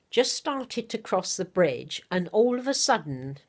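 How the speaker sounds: ordinary talk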